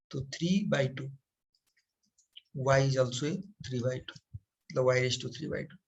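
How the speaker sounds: slow and quiet, word by word; slow dictation. Shown as a demonstration. Teacher dictating the answer while writing, slow and quiet. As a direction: drag each word out as you write it.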